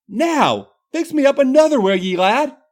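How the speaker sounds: high, loud Irish order to the barman; Irish accent demanding. Acted. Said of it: "in her thick Irish accent".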